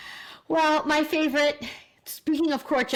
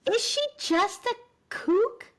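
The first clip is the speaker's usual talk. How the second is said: incredulous; 6 semitones higher than usual. Acted. Mock incredulous question about Kathy.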